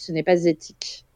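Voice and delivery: whispered, shocked disapproval; mock-solemn whisper; hushed verdict. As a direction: breathe it out as a grave accusation.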